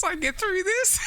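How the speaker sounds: laughing. Real, not acted. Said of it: "If you know".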